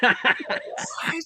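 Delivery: high, breathless mock whisper; startled whisper. Husband grabbed by the throat answers his wife, startled whisper. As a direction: whisper it back, taken aback.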